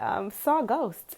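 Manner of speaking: normal talking voice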